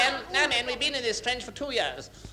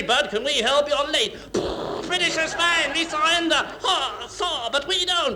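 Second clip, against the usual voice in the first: loud, higher, rapid-fire string of war-film voices; impersonation of the war; 5 semitones higher, 5 dB louder, slower than usual. Acted. John Cleese playing the whole Second World War, loud and in many voices. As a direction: bark out every side of the war in turn. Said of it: "impersonation of the Second World War".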